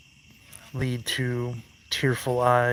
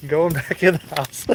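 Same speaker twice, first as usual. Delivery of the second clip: laughing, higher and louder; 4 semitones higher, 5 dB louder than usual. Real, not acted. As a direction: say it through a laugh. Laughing after lightning scare.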